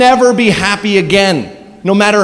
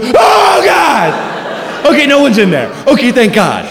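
startled yelp; 6 semitones higher than usual. Retold or imitated. Opening a closet expecting an intruder, fright then relief. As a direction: jump at the closet, then laugh off the scare.

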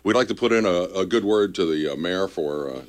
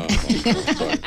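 laughing; 14 semitones higher than usual. Real, not acted. Laughs after fast read.

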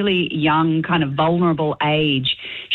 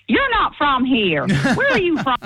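higher, slower mock American twang; imitating a local; 8 semitones higher, slower than usual. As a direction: parrot the locals' Southern drawl, amused. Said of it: "someone says to me".

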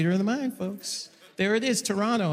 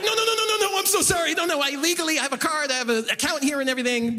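high, frantic pleading; panicked apology; 5 semitones higher, faster than usual. Retold or imitated. Comedian to frightened tellers, frantic high apology. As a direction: back off fast, hands up, begging.

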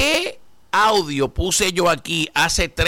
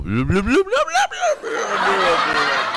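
time machine noise. Pretend.

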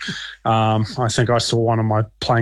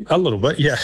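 chuckling through the words, a bit higher; laughing; 4 semitones higher, faster than usual. Real, not acted. Answering the host's masochist joke with a laugh. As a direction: laugh as you admit it.

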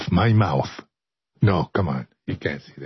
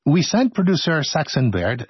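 ordinary delivery

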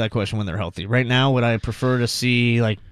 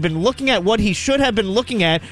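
put-on high, lively Boston accent; 7 semitones higher than usual. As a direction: read Knuckles in a thick Boston accent. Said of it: "With his box, Boston accent".

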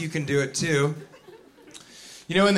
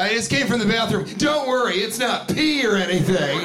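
higher, over-eager joking excuse; forced joker; 5 semitones higher than usual. Acted. Wet-handed greeter making a joke, over-eager. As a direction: chirp the excuse as a lame joke. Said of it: "feel like they have to make a joke".

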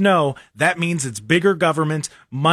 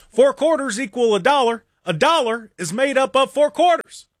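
hammed-up pompous campaign oratory, higher; mock Obama speech; 7 semitones higher than usual. Host mocking Obama's speeches, pompous mock oratory. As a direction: state the obvious like a grand revelation.

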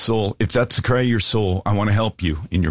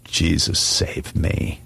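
understated, subdued plea with long pauses; cry of the soul; 3 semitones lower, 3 dB quieter, much slower than usual. Acted. Preacher voices a listener's cry to God, quietly pleading. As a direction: plead simply, leaving space after each plea. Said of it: "is this a cry of your soul".

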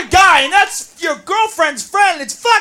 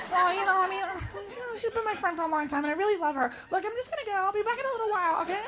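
very soft, placating murmur; small, apologetic voice; meek girlfriend; 16 dB quieter, faster than usual. Acted. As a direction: timidly talk your boyfriend round. Girlfriend meekly placates boyfriend.

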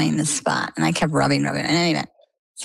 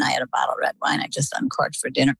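ordinary talk, nothing marked

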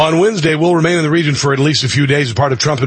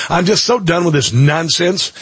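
normal talking voice

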